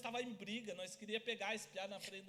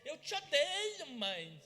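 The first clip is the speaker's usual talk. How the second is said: mock angry child; 3 semitones higher, 5 dB louder, much slower than usual. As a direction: play the sulking boy yelling at mum. Angry child retold to mother. (menino bravo gritando com a mãe, mais agudo e lento)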